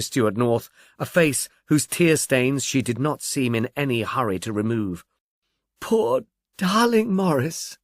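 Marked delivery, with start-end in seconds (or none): 5.8-7.8 high, slow, grieving murmur; murmured grief. Acted. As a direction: mourn him, drawn out and tender. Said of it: "she murmured".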